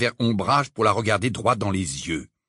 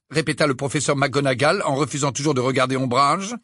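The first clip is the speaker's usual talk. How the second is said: plain reading voice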